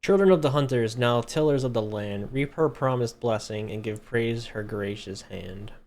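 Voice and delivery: loud, ceremonious proclamation, slowing at the end; grand inscription reading. Pretend. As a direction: declaim it like an ancient carved oath. Player reads third inscription dramatically.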